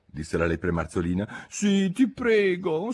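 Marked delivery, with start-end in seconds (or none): 1.5-2.9 high, slow, drawn-out plea; imploring high voice; pleading. A narrator's reading. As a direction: beg sweetly, stretching the words. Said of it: "supplicò Alice".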